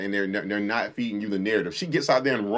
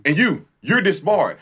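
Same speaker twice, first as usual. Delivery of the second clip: loud, slow, high pronouncement; judge's sentence; 7 semitones higher, 6 dB louder, slower than usual. Retold or imitated. The judge disbars the winning lawyer, loud and slow. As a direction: hand down the verdict with relish. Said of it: "she was like".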